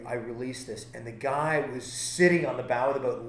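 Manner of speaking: usual speaking voice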